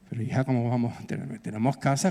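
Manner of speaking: lightly voiced dad, mildly taken aback; father's voice.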